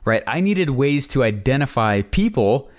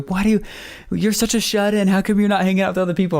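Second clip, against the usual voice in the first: lightly voiced wife, higher and fast, teasing him; imitating nagging wife; 6 semitones higher, faster than usual. Wife chides her homebody husband, mock teasing higher voice. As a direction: tease him like a wife who can't believe he stays home.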